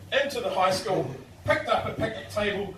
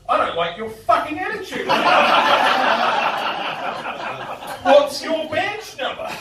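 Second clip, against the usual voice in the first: higher, louder mock outrage; indignant drunk; 9 semitones higher, 7 dB louder than usual. Arrested student telling off the officer, loud and indignant. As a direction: play the offended drunk demanding rights. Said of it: "I countered that with".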